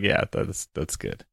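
soft breathy aside; whispered approval. Retold or imitated. Mock approval of yelling.

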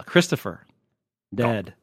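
normal talking voice

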